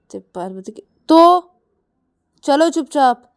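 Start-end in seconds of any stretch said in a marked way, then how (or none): 1.1-3.2 high, loud bossy command; bossy order. Acted. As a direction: order her to shut up and move. Friend orders her to go quietly.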